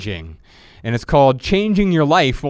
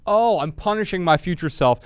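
usual speaking voice

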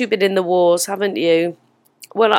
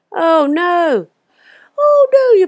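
rising, louder cries of oh no; dismayed; 10 semitones higher, 5 dB louder, slower than usual. Real, not acted. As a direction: wail oh no in mounting dismay. Reacting to friend's bad news.

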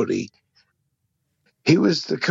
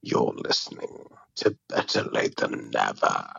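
whispery, voiceless, lower and softer; hoarse covid voice; 12 semitones lower, 4 dB quieter than usual. Real, not acted. Sick caller croaking out the show's greeting, nearly voiceless. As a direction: croak it out with almost no voice. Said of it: "Donkey's covid voice".

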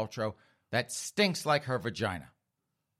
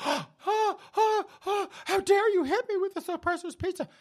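gasping, very high mock-hysterical shriek; 3 dB louder than usual. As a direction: play an outraged daughter gasping and squealing.

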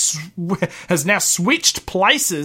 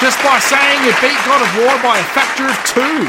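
raised, louder punchline; loud mock exclamation; 7 semitones higher, 5 dB louder than usual. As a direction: belt it out high and loud. Punchline after mock complaint.

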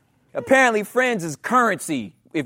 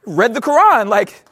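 1 cheerful reply; 4 semitones higher, 6 dB louder than usual. Retold or imitated. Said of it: "It's like".

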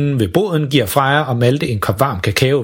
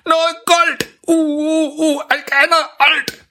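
high, breathy uh uh from a scalded mouth; begging for something cold with a burned tongue; burned-tongue pleading; slower than usual. Acted. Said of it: "han har brændt sin tunge".

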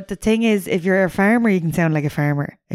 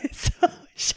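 laughing whisper; 8 dB quieter than usual. Real, not acted. Laughing aside.

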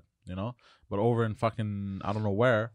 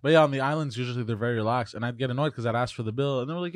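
usual speaking voice

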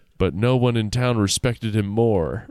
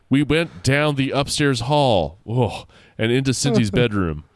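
usual speaking voice

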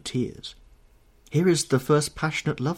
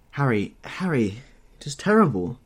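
an understated cry of horror, louder on "terrible"; distressed cry; 3 semitones higher, 4 dB louder than usual. A narrator's reading. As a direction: cry out to a friend in dismay. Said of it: "cried the lad".

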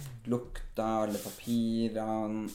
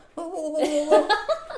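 high laugh; 9 dB louder than usual. Real, not acted. Laughs at own road rage.

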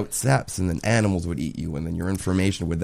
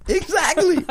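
laughing; slower than usual. Real, not acted. Laughing at dad story.

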